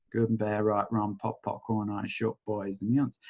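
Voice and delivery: tossed-off chant, dropping away; quick mumbled incantation. Acted. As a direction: rote clearing phrase, murmured. Recites clearing statement.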